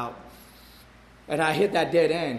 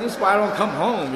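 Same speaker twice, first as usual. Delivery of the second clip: heated retort; 6 semitones higher, 7 dB louder than usual. Retold or imitated. Arguing with his wife.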